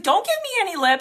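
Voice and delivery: fast, high mock scolding; motherly scolding. Stranger mothering a reluctant shopper, mock scolding.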